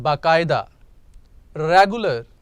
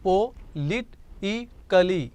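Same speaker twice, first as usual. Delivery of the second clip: politically said very slowly, each syllable apart: po-li-ti-cal-ly; 3 dB quieter, much slower than usual. Shown as a demonstration. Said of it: "total पांच syllables बने".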